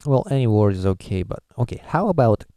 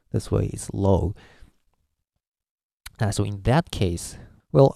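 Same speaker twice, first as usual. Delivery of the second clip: ordinary delivery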